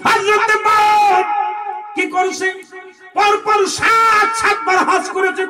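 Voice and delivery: high, loud, drawn-out sermon cry; full-force preaching. Real, not acted. Preacher urges listeners to hear an old story, loud high preaching. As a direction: stretch key words out in a high shout.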